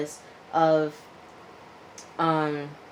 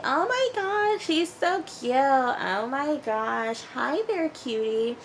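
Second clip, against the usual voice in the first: put-on much higher, slow baby-talk coo; cooing over baby; 9 semitones higher, slower than usual. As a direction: gush over the baby in a high voice.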